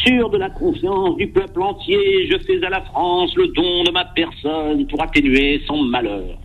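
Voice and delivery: put-on high, slow, trembling old man; quavering Pétain voice. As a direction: quaver like an old marshal in 1940. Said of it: "je vous sors ma meilleure voix chevrotante".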